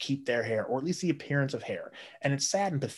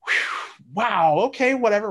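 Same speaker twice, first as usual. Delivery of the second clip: high, loud sarcastic gushing; a much higher, louder sarcastic whew and wow; mock compliment; 13 semitones higher, 7 dB louder than usual. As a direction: tease him with fake admiration. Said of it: "we're like".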